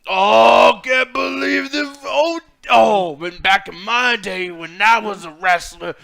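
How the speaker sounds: loud, slow, hammed-up old wrestler voice; mock Booker T. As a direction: parody a rambling commentator. Mocking Booker T commentary.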